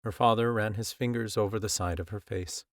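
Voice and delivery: ordinary delivery, nothing marked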